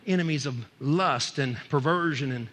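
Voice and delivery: ordinary delivery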